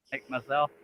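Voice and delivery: ordinary talk, nothing marked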